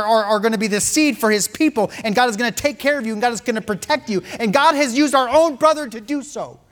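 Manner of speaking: high, loud, building sermon cry; preaching at full force. Acted. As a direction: preach it out, rising to a peak.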